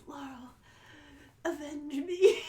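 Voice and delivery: faint dying breath, then a laugh; overacted death scene. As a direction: gasp it out with the last breath. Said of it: "Matt, in his dying breath".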